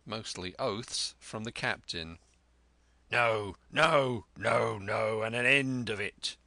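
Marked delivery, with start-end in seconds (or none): 3.1-6.4 loud, emphatic captain's refusal; crying out. A narrator's reading. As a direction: put your foot down in a rough pirate quarrel. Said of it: "he cried once".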